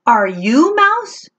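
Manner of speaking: high, slow little kitten voice; kitten Max asking. Acted. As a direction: ask it sweetly and carefully. Max asks the fly.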